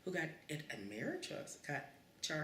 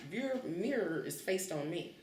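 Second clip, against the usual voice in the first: ordinary talk, nothing marked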